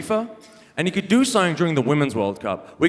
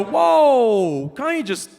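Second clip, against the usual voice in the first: mock alarmed; 8 semitones higher, much slower than usual. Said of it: "you'd go".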